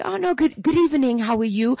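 high-pitched polite diner voice; greeting the waiter. Retold or imitated. Diner greeting the waiter who comes about, put-on politeness. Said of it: "the waiter comes about".

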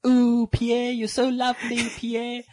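high, gushing mock swoon over Pierre; swooning fans. South Africans swooning over a Frenchman, high and gushing. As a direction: coo at Pierre like an adoring fan. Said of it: "all the South Africans were like".

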